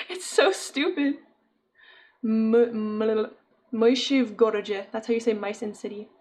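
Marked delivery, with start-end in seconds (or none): 2.2-4.9 halting Russian in a rough learner's accent; scuffed Russian accent. Shown as a demonstration. Streamer showing viewers how to say mice in city, scuffed Russian accent. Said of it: "In my scuffed ass accent".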